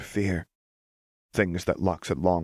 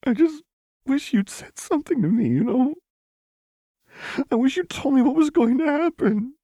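high, loud, tear-soaked pleading; crying plea; 6 dB louder than usual. A narrator's reading. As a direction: cry it out, wounded and begging. Lux crying her hurt out.

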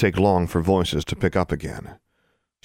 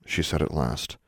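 ordinary delivery

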